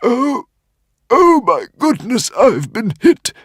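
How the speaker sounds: high, loud panicked squeak; alarmed squeak. A narrator's reading. Dragon realising he's been darted, high alarmed squeak. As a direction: squeak in shock. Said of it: "squeaked the Woden's Fang".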